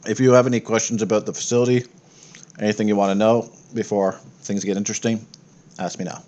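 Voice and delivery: ordinary talk, nothing marked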